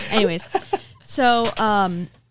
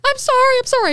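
very high, loud retold apology; mock frantic apology; 8 dB louder than usual. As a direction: squeak out a flustered apology. Retold panicked apology to woman she hit.

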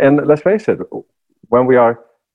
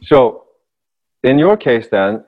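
normal talking voice

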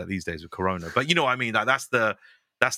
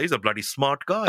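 imitating dad defending Chapati; 3 semitones higher than usual. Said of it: "He's like".

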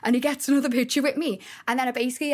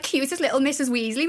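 normal talking voice

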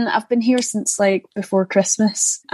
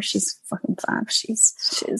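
hushed aside; whispering; 8 dB quieter than usual. Real, not acted. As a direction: drop to a whisper for the swear. Swearing about co-star on air.

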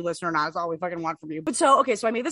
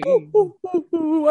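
spooky ghost wail; 7 semitones higher, 5 dB louder than usual. Acted. Ghostly oohs in spooky intro.

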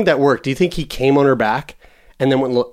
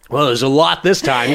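ordinary talk

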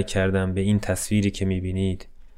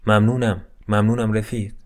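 ordinary delivery

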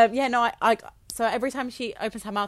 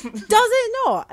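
surprised; 14 semitones higher, 8 dB louder, much slower than usual. Real, not acted. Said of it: "surprised reaction".

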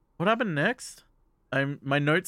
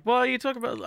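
high, startled disbelief; a lightly voiced girl waving off the compliment; incredulous; 10 semitones higher, much faster than usual. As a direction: jump up in pitch, taken aback. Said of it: "He's like".

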